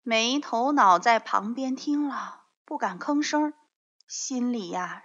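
ordinary delivery, nothing marked

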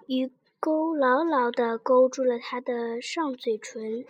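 usual speaking voice

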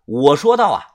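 ordinary delivery

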